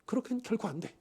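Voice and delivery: softly repeated refusal; quiet repeat. Acted. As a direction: drop the voice for the second 그렇게는. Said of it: "아주 단독지식적으로 이야기를 하고 있어요".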